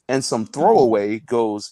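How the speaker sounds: ordinary talk, nothing marked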